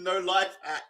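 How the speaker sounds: ordinary delivery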